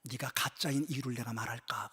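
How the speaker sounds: whispered, low and soft; a quiet divine question in a whisper; God's hushed voice. Retold or imitated. God quietly asking the pastor, whispered. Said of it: "주님이 이렇게 내게 말씀하시더라고".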